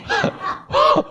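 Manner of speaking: a sudden squealing laugh, high up; high burst of laughter. Real, not acted. Preacher laughing at grandpa climbing into the crib, high laugh. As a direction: break into a high laugh at the funny image.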